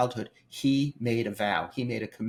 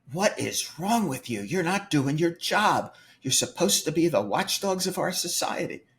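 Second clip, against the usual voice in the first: mock outrage, higher and louder; retold angry yelling; 6 semitones higher, 3 dB louder than usual. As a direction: put on a furious taxpayer's rant. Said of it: "I proceed to scream at this man".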